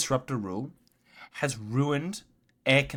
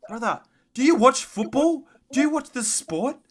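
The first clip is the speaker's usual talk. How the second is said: much higher, louder outraged rant; exasperated yell; 12 semitones higher, 7 dB louder than usual. Real, not acted. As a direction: yell in disbelief at the commentator. Ranting at commentator.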